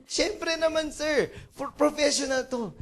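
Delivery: high-pitched, offended confidence; cocksure photographer. Acted. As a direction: brush off the boss's doubt. Photographer insists he is professional.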